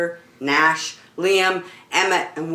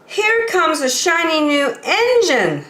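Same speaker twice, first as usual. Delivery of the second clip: high, eager clown voice; excited little toy clown; funny little clown; 9 semitones higher than usual. Acted. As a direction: squeak it out like a cheerful toy clown. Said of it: "said the funny little clown".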